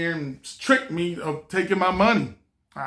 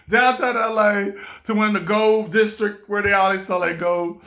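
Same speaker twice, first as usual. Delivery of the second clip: normal talking voice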